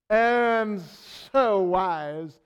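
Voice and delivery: hammed-up British self-congratulation; British accent. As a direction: put on a British accent for a self-satisfied boast. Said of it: "I speak with a British accent".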